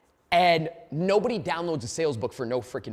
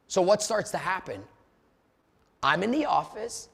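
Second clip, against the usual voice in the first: normal talking voice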